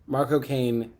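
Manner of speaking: ordinary talk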